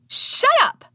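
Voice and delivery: very high, loud yell; frustrated scream. A narrator's reading. Frustrated woman screaming at her sidekick, furious. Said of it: "I screamed".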